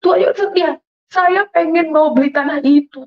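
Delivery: high-pitched begging prayer; retold tearful plea. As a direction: re-enact pleading with God, childlike and high. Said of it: "Saya menangis di belakang kosan". (permohonan memelas yang ditirukan)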